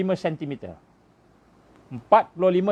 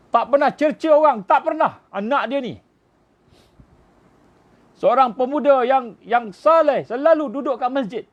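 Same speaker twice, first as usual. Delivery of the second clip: preaching at full force; 7 semitones higher, 4 dB louder than usual. Acted. Preacher extols the young man to the congregation, impassioned and loud. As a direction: pour out praise from the pulpit to the congregation, rising fervour. Preacher praising the martyr, rising.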